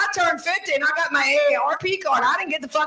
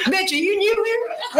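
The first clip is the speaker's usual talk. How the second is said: slow, higher, sassy retort with a laugh; sassy comeback; 7 semitones higher, slower than usual. Retold or imitated. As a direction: put them in their place, drawn out. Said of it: "they come up to me and go".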